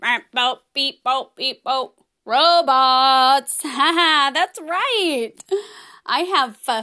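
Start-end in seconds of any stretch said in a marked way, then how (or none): 0.0-1.9 low, even robot beeps and boops; robot beeping. Pretend. Acting as a robot, show opener. 2.3-3.6 robot announcer. Pretend. Announces the topic in robot style.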